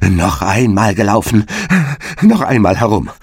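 a touch of panic, calling out; frightened cry. Acted. Hare cries out in fear to the hedgehog. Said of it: "außer sich vor Furcht und schrie".

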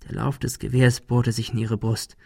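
ordinary delivery, nothing marked

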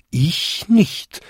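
high, ringing little denial; smaller bells chiming. A narrator's reading. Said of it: "bampten und bimmten die nächsten fünf Glocken".

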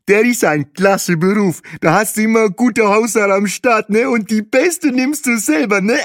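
very high, loud, gushing character voice; mouth full. A narrator's reading. Bahe praises the estate agent's trade, high-pitched and mouth full. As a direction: enthuse through food, ending in a laugh. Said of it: "sagte Bahe mit vollem Mund".

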